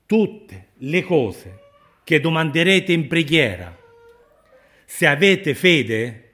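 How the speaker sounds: loud, high, deliberate preaching; impassioned proclamation. Real, not acted. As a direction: drive the promise home forcefully. Preacher proclaims promise at full force.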